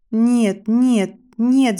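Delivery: loud, desperate no, choking on tears; screaming through tears. A narrator's reading. Girl at the chopping block screams in terror, sobbing. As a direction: scream no as the axe comes. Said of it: "закричала я, давясь солёными слезами".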